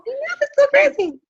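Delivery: very high, laughing reply; laughing agreement. Real, not acted. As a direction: squeal it through a real laugh. Laughs along with listener's praise.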